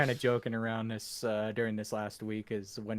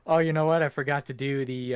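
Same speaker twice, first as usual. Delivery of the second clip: ordinary talk, nothing marked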